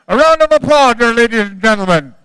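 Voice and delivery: loud, fast crowd call; rally the crowd for applause; emcee hype call. Real, not acted.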